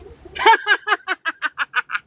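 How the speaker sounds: a loud, high cackle of glee; gleeful laugh. Real, not acted. Laughing after shushing Tracy.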